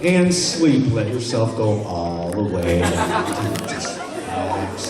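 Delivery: low, slow soothing hypnotist voice; hypnotic induction. Shown as a demonstration. Hypnotist puts volunteer back to sleep. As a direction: ease them into sleep. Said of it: "hypnotist induction".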